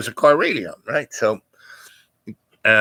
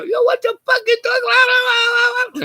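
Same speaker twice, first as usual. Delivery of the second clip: much higher, fast furious mock yell of his wife; wife screaming; much faster than usual. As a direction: shriek it like the angry wife out the window. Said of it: "I can hear her screaming".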